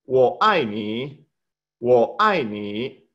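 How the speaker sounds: Wo ai ni said slowly, each syllable spaced apart; slow Mandarin drill. Shown as a demonstration. Teacher slowly drilling Wo ai ni for the class. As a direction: spell out Wo ai ni syllable by syllable. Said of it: "voy a repetir la pronunciación".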